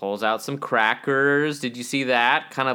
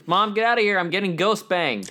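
yelling at mom; 9 semitones higher, 5 dB louder than usual. Retold or imitated.

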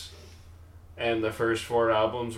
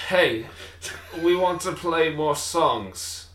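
louder, higher mock British, drawn out; hammed-up British accent, slow and raised; 6 semitones higher, 5 dB louder, slower than usual. Repeating the band's demand for a teasing friend, mock British accent. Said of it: "what was that accent again".

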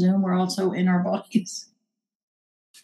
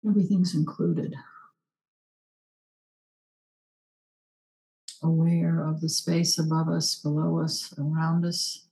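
guided meditation; 2 semitones lower, 3 dB quieter than usual. Acted. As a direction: hush down and guide them inward. Leads a short meditation.

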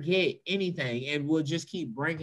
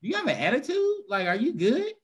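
much higher, fast incredulous question; mock high question; 7 semitones higher than usual. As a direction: pitch it up like you can't believe her mood. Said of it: "finally, I'm go".

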